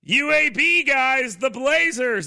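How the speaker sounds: high, loud and slow hammed-up heel; wrestler trash talk. Wrestler baiting the local crowd, slow hammed-up taunt. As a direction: drag it out to rile the crowd. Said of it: "that professional wrestling thing".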